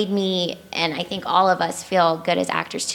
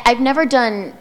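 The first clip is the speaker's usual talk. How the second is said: normal talking voice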